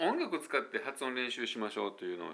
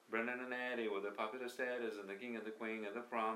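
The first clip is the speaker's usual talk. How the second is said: fast rattled lyric; 5 dB quieter than usual. Shown as a demonstration. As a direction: rattle the lyrics off quickly and softly. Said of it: "こんな感じの、あの、結構速いんですよね". (速くまくし立てる歌詞)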